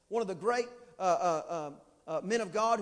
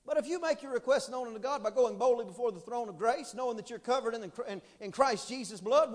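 ordinary delivery

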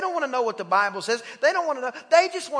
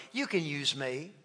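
quiet prayer; 9 semitones lower, 11 dB quieter than usual. Acted.

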